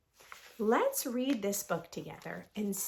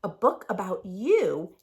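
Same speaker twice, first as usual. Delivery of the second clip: lively sing-song picture book read; reading to children; 3 semitones higher, 4 dB louder than usual. A narrator's reading. Teacher reads the rhyming book to her class. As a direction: read the rhymes aloud with bounce.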